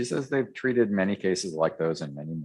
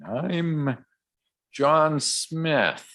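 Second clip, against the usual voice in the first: higher, slowed-down caricature of a fake alias; mock self-introduction; 8 semitones higher, slower than usual. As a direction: lightly act it out, drawn out and pitched up. Acting out a phony self-introduction.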